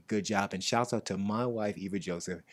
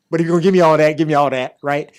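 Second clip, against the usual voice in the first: higher, much louder mock preacher call; hammed-up preacher appeal; 6 semitones higher, 16 dB louder, faster than usual. Real, not acted. Host pleading for donations, mock preacher shout. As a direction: belt it like a money preacher, joking.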